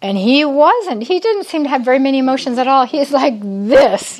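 ordinary talk

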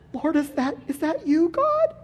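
very high, small voice; tentative question. Acted. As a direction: ask God timidly, pitch way up. Believer doubtfully answers the voice.

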